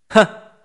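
one loud, high scornful "huh"; sudden derisive snort of laughter; cold scoffing laugh. A narrator's reading. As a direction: scoff coldly in a single burst. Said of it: "đột nhiên cười lạnh một tiếng".

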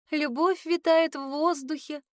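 lightly voiced girl lost in daydreams; dreamy sigh. A narrator's reading. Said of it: "вздохнула мечтательно".

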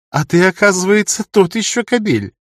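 lively, high-voiced amusement; amused teasing. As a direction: tease with mock accusation, pitched high. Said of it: "захохотала Лема".

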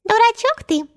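high-pitched fairy squeal at normal volume; Tinker Bell's cry. A narrator's reading. Tinker Bell taunting Tootles as she flies over, high cry. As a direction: pipe it up high like a tiny fairy. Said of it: "Динь-Динь закричала".

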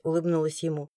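ordinary delivery

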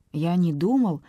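ordinary delivery, nothing marked

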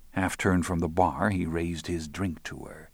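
usual speaking voice

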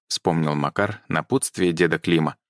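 ordinary delivery

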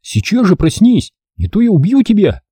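high, cross threat; angry threat. A narrator's reading. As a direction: threaten her in anger. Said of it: "рассердился Торок".

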